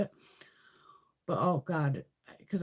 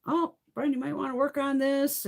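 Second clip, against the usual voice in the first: reflexologist; 8 semitones higher than usual. Retold or imitated. Reflexologist voiced in story.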